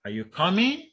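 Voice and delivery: COMING swept sharply up high at the end; rising question intonation. Shown as a demonstration. Lecturer showing students a polar question, rising intonation. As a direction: lift the pitch steeply through "coming". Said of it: "a rising intonation".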